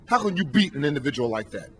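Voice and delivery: ordinary delivery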